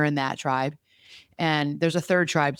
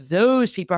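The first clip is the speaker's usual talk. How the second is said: THOSE set apart as a quoted label; air-quoted; 6 semitones higher, 5 dB louder than usual. Real, not acted. Challenging a friend's othering of people, air-quoted "those". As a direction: stress "those" as if in quote marks. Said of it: "air quote".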